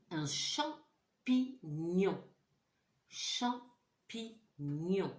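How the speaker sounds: Champignon drawn out slowly, syllable by syllable; teacher to class, stretching Champignon slowly; slow syllable demo. Shown as a demonstration. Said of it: "Est-ce que j'entends mieux?".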